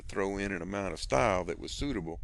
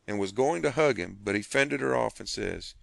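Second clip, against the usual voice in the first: usual speaking voice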